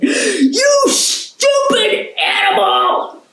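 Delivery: high, slow yelled insult; furious dog shout. Acted. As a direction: bellow at the cat in rage. Dog sees stone drop.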